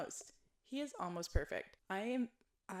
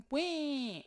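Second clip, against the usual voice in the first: high, loud hammed-up wail; mocking the prince with a bratty wail; mock crybaby whine; 9 semitones higher, 7 dB louder than usual. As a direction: whine it like a sulking toddler. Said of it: "And you're like".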